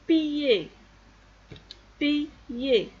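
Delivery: "piller" sounded out as pi, yé; mot modèle. Shown as a demonstration. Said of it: "ça se prononce quoi". (« piller » articulé en pi, yé)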